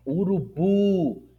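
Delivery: urubu with BU high and stretched; slow urubu, punched on the last syllable; stress on last syllable. Shown as a demonstration. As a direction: lift and hold the final BU of urubu. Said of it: "perceba que é na última sílaba".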